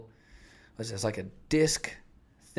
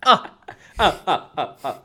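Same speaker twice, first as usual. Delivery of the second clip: loud burst of laughter; laughing; 6 semitones higher, 9 dB louder than usual. Real, not acted. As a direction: break into big laughs. Laughing at Disney idea.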